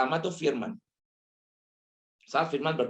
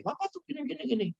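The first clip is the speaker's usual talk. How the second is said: put-on child complaining; 5 dB quieter, faster than usual. Grown child criticizing father.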